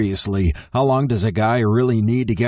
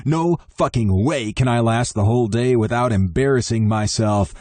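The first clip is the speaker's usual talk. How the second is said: plain reading voice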